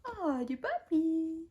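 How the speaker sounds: high, soft puppy coo. Real, not acted. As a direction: melt over the dog in a high voice. Cooing to her puppy.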